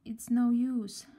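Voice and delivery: slow, sighing despair. A narrator's reading. Little girl sighing it's no use. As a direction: sigh it out, hopeless. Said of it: "she sighed".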